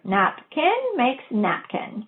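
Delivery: very slow, napkin blended from its parts; slow blending demo. Shown as a demonstration. Teacher showing students how to blend, slowly. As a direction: draw out napkin slowly as a model. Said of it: "I combine the syllables to form the word".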